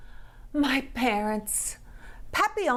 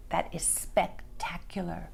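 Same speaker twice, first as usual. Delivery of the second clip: soft, awed whisper; 4 semitones lower, 5 dB quieter than usual. A narrator's reading. Girl whispering her great idea. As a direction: breathe it with wonder.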